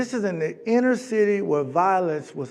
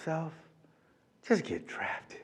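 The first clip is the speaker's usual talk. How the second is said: talking to himself; 15 dB quieter, slower than usual. Retold or imitated. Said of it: "So I said to myself".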